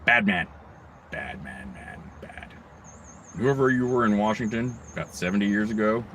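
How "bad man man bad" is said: dropped to a near whisper; bad man, said almost to yourself; hushed repeat. Real, not acted. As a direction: mutter it under your breath, much softer.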